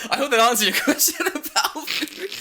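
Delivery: high, loud, breaking into laughter; laughing sign-off. Real, not acted. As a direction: crack up while wrapping up the tangent. Laughing apology to listener after tangent.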